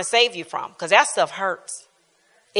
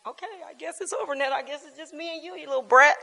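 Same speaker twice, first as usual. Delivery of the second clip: mock crying; 5 semitones higher, 7 dB quieter, faster than usual. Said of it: "in the room crying like".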